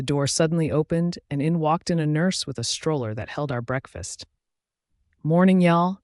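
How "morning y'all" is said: chirpy country greeting, higher and louder; bright country accent. A narrator's reading. Nurse greeting two patients, bright country accent. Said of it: "she chirped in a bright country accent".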